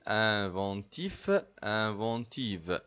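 "Inventive" with a clear final v, "Inventif" with a clear final f, slowly; slow teacher's drill of "Inventif" ending in f and "Inventive" ending in v; slow contrast drill. Shown as a demonstration. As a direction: spell out "Inventif" against "Inventive", slow and careful. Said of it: "فرق ما بين الاتنين".

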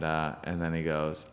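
ordinary delivery

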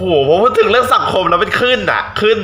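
suddenly worked up about the subject; real riled-up exclamation; fired up. Real, not acted.

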